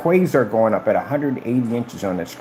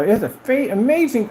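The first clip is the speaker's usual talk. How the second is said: mock salesman gush; 13 semitones higher than usual. Best Buy salesman retold.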